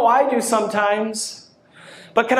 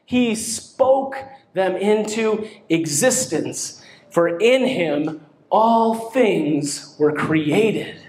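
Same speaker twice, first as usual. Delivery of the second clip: 1 slow solemn emphasis; 5 semitones lower, much slower than usual. Real, not acted. Slows on scripture.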